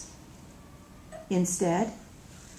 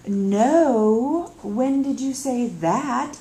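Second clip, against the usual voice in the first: demanding; 4 semitones higher, 6 dB louder, much slower than usual. Acted. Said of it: "I demand".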